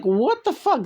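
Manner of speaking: indignant Lena rant, pitched high; Lena impression. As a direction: channel Lena fed up with them. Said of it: "that was my Lena impression".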